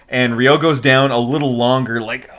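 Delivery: ordinary delivery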